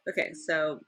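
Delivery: ordinary delivery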